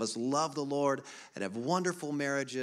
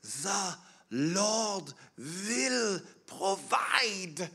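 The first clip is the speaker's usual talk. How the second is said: put-on slow, high Swiss-accented quote of an elderly mother; 7 semitones higher, much slower than usual. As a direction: imitate mother's Swiss accent, each word drawn out. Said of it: "in her Swiss accent".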